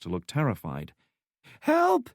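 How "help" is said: high, loud panicked cries for help; cry for help. Acted. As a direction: shriek for help as if terrified. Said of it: "she cried".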